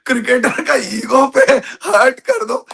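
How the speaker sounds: laughing through the words. Real, not acted. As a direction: laugh it off, pitch jumping up. Caught out, laughing it off. (हँसते हुए)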